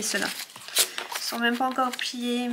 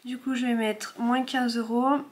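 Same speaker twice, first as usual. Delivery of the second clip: normal talking voice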